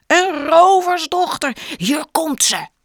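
loud, high, proud announcement; joyful shout. Acted. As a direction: shout it out in triumph. Mattis announces the baby, overjoyed.

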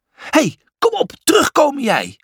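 high, exasperated shout; yelling after someone. A narrator's reading. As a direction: order a runaway to come back. Yelling after the brother running ahead. (hoog en dwingend naschreeuwen)

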